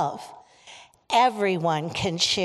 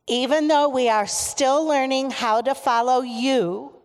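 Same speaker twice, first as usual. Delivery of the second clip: higher, projected prayer call; 4 semitones higher, 4 dB louder than usual. Real, not acted. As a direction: lead the group with a lifted voice. Leading children's call-and-response prayer.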